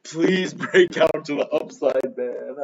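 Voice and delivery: high, slow, laughing plea; pleading. Retold or imitated. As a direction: beg the chart, half laughing. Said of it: "I was just like".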